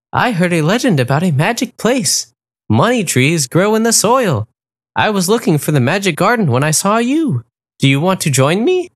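high, encouraging flamingo voice; flamingo Dahlia. A narrator's reading. Dahlia encouraging the wolf, high and kind. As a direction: lift the voice like a kind bird.

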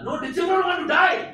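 high, loud mocking yell; a hammed-up shouted refusal. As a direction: shout it back as the defiant cultists. Mock shouted reply from compound.

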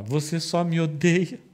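high, loud wounded wail building up; whining accusation. Acted. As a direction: play the hurt wife pleading, voice climbing. Wife accuses Samson tearfully.

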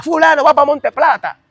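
agudo, fuerte y pausado; anúnciele el traslado como guardia; anuncio de guardia a gritos. Retold or imitated. (guardia gritando el traslado)

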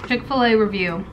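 talking while chewing food; words around a mouthful; mouth full, chewing. Real, not acted. Seen 3: Both eat; she bites small piece, chews throughout; speaker unclear.

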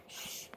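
one soft hush; shushing. Real, not acted. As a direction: shush the dog. Hushing the dog.

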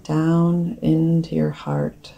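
guided meditation. Acted. Meditation leader guiding listeners to breathe from the heart, calm guided-meditation voice.

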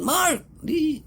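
high sharp call of the name; retold shout. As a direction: summon him with a sudden yell. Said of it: "laging pag nagagalit yung sinisigawan". (biglang sigaw ng pangalan)

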